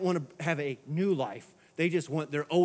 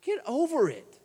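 usual speaking voice

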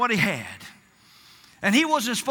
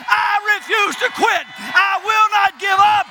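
high, loud, slow declaration; shouted declaration; 7 semitones higher, 6 dB louder, slower than usual. Acted. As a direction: shout it out in defiance. Declaring refusal to quit.

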